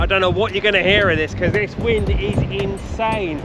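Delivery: shouting over wind. Real, not acted. Filming in insane wind.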